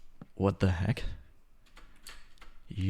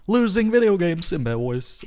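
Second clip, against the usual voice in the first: higher, hammed-up horror; mock dread; 8 semitones higher, 3 dB louder than usual. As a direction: play up the terror of it. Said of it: "I can't even say it without".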